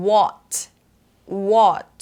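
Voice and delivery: WHAT with a short rounded o, crisp T; British short o. Shown as a demonstration. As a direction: say WHAT with small rounded lips, British style. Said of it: "British ก็จะปากกลมเล็กนะ". (พูด what ปากกลมเล็กแบบอังกฤษ)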